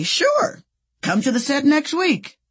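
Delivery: high, breezy mock invitation; exaggeratedly obliging, high-pitched; imagined eager yes. Imagines the answer Colleen wanted.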